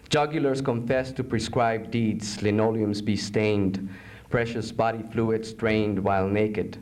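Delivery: low, measured poetry reading; solemn poem narration. Acted. Poet reading a poem about torture, low and solemn. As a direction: intone the grim images evenly.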